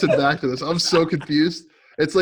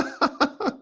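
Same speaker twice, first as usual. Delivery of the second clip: high-pitched creepy cackle; demented laugh; 12 semitones higher, 4 dB quieter than usual. Real, not acted. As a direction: cackle away, high and unhinged. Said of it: "your laugh sounds demented".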